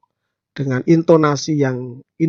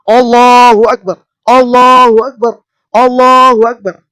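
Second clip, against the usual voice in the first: much louder and higher, a fiery battle cry; Bung Tomo rousing shout; 10 semitones higher, 12 dB louder than usual. Shown as a demonstration. Bung Tomo firing up the masses, a full-throated rousing shout. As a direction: roar it out to set the crowd ablaze. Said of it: "dengan kerasnya mengatakan".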